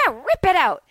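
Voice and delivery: high, loud imitation of an excited attendant; excited urging. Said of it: "She was all excited".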